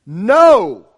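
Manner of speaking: a sharp shouted No!; the word No! shouted out firmly; loud clear no. Shown as a demonstration. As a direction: bark No! as a firm refusal. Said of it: "say it very clearly and very loudly".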